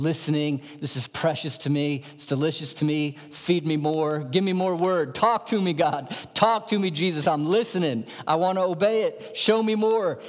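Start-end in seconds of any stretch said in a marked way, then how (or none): 3.5-10.2 fervent plea. Acted. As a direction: beg God to speak, building. Voicing the hungry believer.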